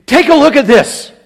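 high, loud, urgent call to look; commanding exclamation. Shown as a demonstration. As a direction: grab them by the collar with it. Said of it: "with an imperative inflection".

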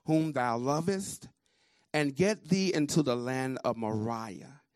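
solemn scripture reading, energetic and unhurried; slow, projected reading. Real, not acted. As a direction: read it slowly, projecting with deliberate pauses. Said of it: "slowly with energy and project".

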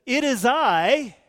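loud, slow and firm: "It is I"; firm declaration. Acted. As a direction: proclaim "It is I" slowly, with full weight. Preacher re-enacts Jesus' words.

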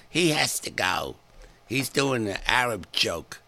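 slow, lightly voiced indignant lady; a hint of a prim complaining woman; offended lady. Offended lady demanding the owner fire the comic, slow and prim.

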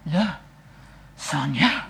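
Le Sanya split in two, with Le as a French article; Le Sanya, posh and mock-French; pompous mock pronunciation. Shown as a demonstration. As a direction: say Le Sanya as two words, stress on San. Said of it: "doesn't get boring to say".

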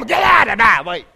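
a hint of a mean drunk father shouting; gruff drunk daddy. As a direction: bark it like a drunk dad at the door. Drunk daddy voiced coming home.

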